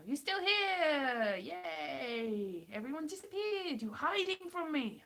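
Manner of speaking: high, drawn-out happy call to viewers; excited relief. Real, not acted. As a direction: cheer them with long, high swoops. Calls out to returning stream viewers.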